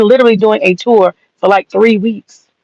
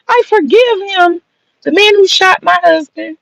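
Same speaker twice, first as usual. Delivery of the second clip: mock pious widow; 8 semitones higher than usual. Mocking Erica Kirk's public statement.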